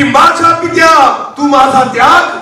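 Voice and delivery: high, drawn-out cry to the Father; preacher's re-enacted anguished call. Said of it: "येशू एका गोष्टीमुळे ओरडला".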